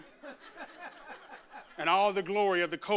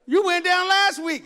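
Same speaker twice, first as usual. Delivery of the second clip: scolding; 9 semitones higher, 8 dB louder than usual. Retold or imitated. Scolding a repeat convert.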